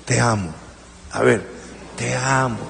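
low, quiet demo of te amo; soft te amo. Shown as a demonstration. Preacher showing congregation what to tell neighbour, soft. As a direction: show them how to say it gently. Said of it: "Dígale al que está al lado suyo".

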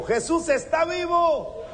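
high triumphant shout; full-voice preacher's shout; shouted proclamation. Real, not acted. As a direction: shout it to lift the room. Call and response with congregation.

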